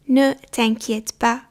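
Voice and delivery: Ne t'inquiète pas said slowly, each syllable apart; slow, careful repeat. Shown as a demonstration. Teacher to learners, slow model repeat. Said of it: "teacher repeats slowly for learners".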